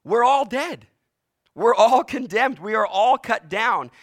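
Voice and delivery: loud, high ringing proclamation; preaching at full force. Real, not acted. Preacher warning the congregation, loud and high. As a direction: declare it to the whole room. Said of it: "preaching climax".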